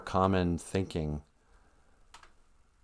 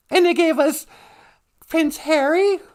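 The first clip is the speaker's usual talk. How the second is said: high, sing-song mock voice, far above normal; 7 dB louder than usual. To the chat, a squeaky high joke. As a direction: squeak it out like a sarcastic aside.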